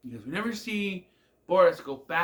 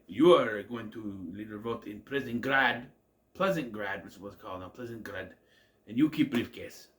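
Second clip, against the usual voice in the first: put-on Russian accent; 5 semitones lower than usual. Boris voiced in a recap.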